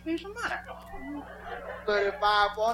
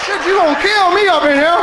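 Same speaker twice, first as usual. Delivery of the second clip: loud, higher mock cry; loud, higher mock outcry; mock shout; 8 semitones higher, 9 dB louder than usual. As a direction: yell it like she is killing you. Retold plea during sex.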